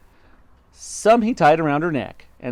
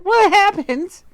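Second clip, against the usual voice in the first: very high, loud, drawn-out alarm; gasped question; 7 dB louder, slower than usual. A narrator's reading. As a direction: wail the question in dread. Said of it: "gasped Miss Twit".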